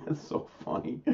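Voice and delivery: breathless soft laugh through the words. Real, not acted. Host amused at being targeted, laughing as he talks. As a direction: barely get it out for laughing. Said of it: "I'm laughing because they're so ridiculous".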